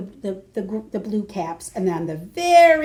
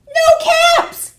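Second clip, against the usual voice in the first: much higher, louder cry of dismay; alarmed cry; 9 dB louder than usual. Acted. Peddler finding no caps, high alarmed cry.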